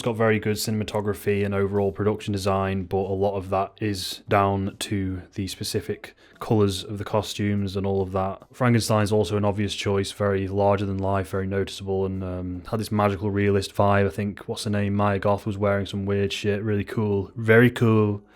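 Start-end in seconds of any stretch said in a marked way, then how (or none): none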